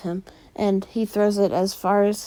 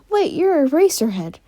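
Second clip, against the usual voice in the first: a sudden higher, louder gasp of recognition; surprised realization; 7 semitones higher, 4 dB louder than usual. Retold or imitated. Izuku recognizing his teacher, startled and loud. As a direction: catch yourself as it dawns on you who he is.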